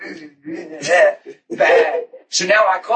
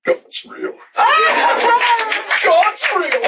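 high, laughing, half-shouted joy; giddy joyful laughing; slower than usual. Retold or imitated. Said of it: "I'm standing there like this".

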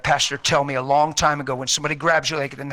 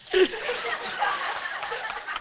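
high laugh; 8 dB quieter than usual. Retold or imitated. Wife laughing after coma.

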